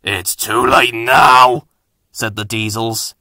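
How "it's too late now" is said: slow gloating. Acted. Diesels gloat.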